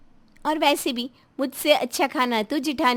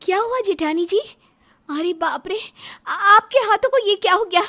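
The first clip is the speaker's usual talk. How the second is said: high, worried questions growing louder; alarmed concern; 7 semitones higher, 5 dB louder than usual. Acted. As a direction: gasp out shocked concern, rising in alarm. Alarmed at blistered hands. (घबराकर चिंता से पूछते हुए)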